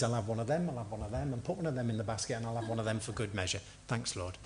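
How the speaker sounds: quick, quiet, low toss-off; offhand shopper. Acted. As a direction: rattle it off casually like filling a basket. Mimes shopper treating God as shop.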